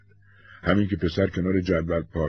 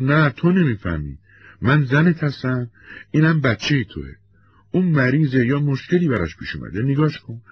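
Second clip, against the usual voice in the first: upset pleading; 7 semitones higher, 3 dB louder than usual. Acted. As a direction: press each word on the husband. Wife pleads in quarrel.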